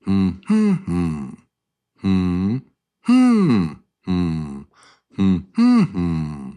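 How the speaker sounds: wordless hum, the middle note leaping up; hummed word rhythm, strong middle beat; humming the stress pattern. Shown as a demonstration. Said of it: "We can even hum it".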